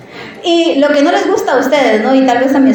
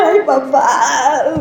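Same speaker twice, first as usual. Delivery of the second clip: teary sigh; much slower than usual. Retold or imitated. Touched daughter, crying laugh.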